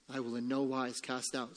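soft, hushed promise; Christ's reassurance. Acted. Preacher voicing Christ to the empty-handed, hushed reassurance. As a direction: answer very quietly and calmly. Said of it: "says Christ".

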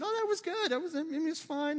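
high, forced fake praise; strained praise. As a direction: squeeze out a reluctant compliment. Dad forcing praise to son.

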